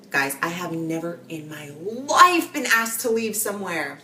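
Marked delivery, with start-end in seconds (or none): none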